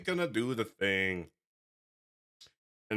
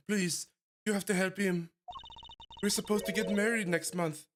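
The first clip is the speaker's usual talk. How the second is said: higher pleading woman's voice; pleading young woman; 7 semitones higher than usual. Acted. Fiancée begging the lawyer to help him, pleading. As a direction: beg for help, voice lifted.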